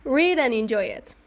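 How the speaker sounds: usual speaking voice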